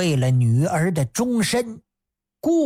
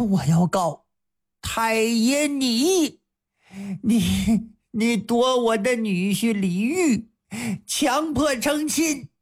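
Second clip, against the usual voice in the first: drawn-out, rising higher accusation; accusing the magistrate; 4 semitones higher than usual. Acted. Wang Xia accusing the magistrate himself, rising higher. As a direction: stretch out the words, then fire the charge.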